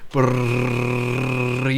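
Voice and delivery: Burritos with the r trilled for seconds; long rolled r. Shown as a demonstration. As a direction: hold the trill on Burritos, very slow. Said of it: "hold the R for two seconds".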